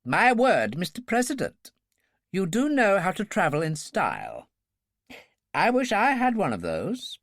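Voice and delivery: put-on an upper-class Oxford accent, eager for the machine; clipped Oxford accent. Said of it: "in his clipped Oxford accent".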